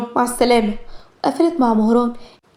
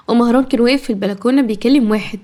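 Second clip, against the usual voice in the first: usual speaking voice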